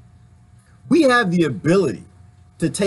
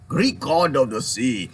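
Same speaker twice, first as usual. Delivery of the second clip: slow mock Irish accent again, lightly put on; a hint of Irish lilt, drawn out; 2 semitones lower, slower than usual. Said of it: "Was that an Irish one?".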